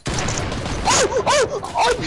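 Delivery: high breathy "oh, oh"; excited gasp. Real, not acted. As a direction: gasp at the near miss. Near miss on a shot.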